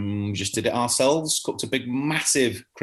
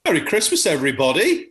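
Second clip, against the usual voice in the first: jolly greeting; 6 semitones higher, 4 dB louder, faster than usual. Acted.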